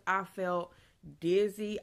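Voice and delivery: ordinary talk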